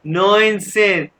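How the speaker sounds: ordinary talk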